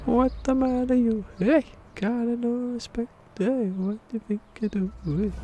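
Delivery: hammed-up squeaky wise guy; squeaky falsetto taunt. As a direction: taunt like a tiny cartoon tough guy.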